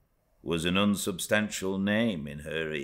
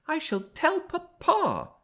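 put-on much higher, petulant child's threat; spoilt young Cathy; 4 dB louder than usual. A narrator's reading. Young Cathy threatening the servant, high childish voice. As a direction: pipe it up like a spoilt little girl.

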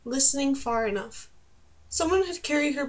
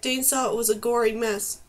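ordinary delivery

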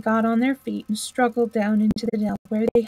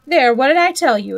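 louder, triumphant exclamation; 3 semitones higher, 6 dB louder than usual. A narrator's reading. Rat uncovers the doormat, in great triumph. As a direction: crow it as proof of being right. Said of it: "exclaimed Rat in great triumph".

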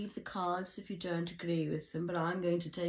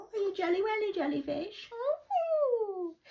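much higher, sing-song teasing voice; mocking taunt; 4 dB louder, slower than usual. Vlogger taunting jealous viewers, in a high sing-song mock. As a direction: tease them like a playground bully.

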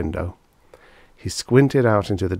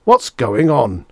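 slow, higher, louder roar; 6 semitones higher, 6 dB louder, slower than usual. A narrator's reading. Lawyer rising from his seat, roaring. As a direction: roar it out in protest. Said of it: "roared Mister Bradbury".

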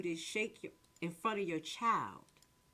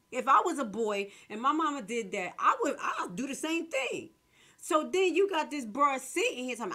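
higher and louder all through; real heated rant; 5 semitones higher, 7 dB louder than usual. Real, not acted. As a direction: build up the outrage. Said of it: "I'm sorry, I'm a little loud".

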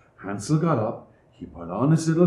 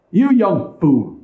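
higher, louder, slow scolding; scolding stepmother; 7 semitones higher, 5 dB louder, slower than usual. A narrator's reading. Stepmother to Hansel, slow and scolding. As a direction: spit the insult at the boy.

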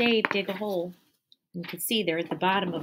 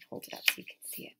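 ordinary delivery, nothing marked

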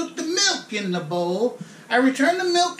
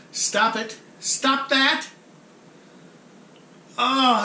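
ordinary talk, nothing marked

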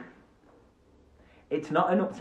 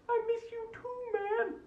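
much higher squeaky little-creature voice; squeaky puppet voice; 4 dB quieter, slower than usual. Pretend. Puppet answering the host in a high squeak. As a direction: pipe it up high like a tiny puppet.

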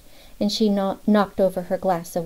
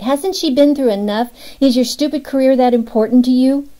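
raised, distraught demand; hurt and lashing out, voice up high and loud; upset outcry; 6 semitones higher, 7 dB louder than usual. A narrator's reading. Upset woman confronts agent.

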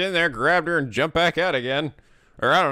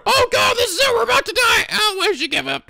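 panicked high voice; 4 dB louder than usual. Acted. Panicked character screaming.

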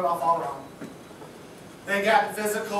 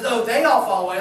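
louder, boastful Peter; Peter boasting; 7 dB louder than usual. Retold or imitated. Peter boasting to the disciples, punched louder. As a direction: trump the other disciples. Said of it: "Peter says".

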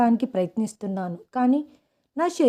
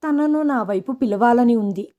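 usual speaking voice

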